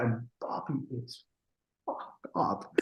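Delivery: hushed, whispered aside; confidential whisper. Real, not acted. Producer confiding about the singer's husband, confidential whisper. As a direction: drop to a whisper for the touchy detail.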